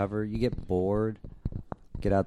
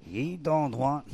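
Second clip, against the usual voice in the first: slow, high growly pirate; 9 semitones higher, slower than usual. Pretend. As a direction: ham it up like a pirate. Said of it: "that's not a pirate voice".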